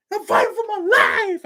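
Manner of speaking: very high, slow, hammed-up wail. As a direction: ham up a man losing it on TV. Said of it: "the man lost it on that [name] interview".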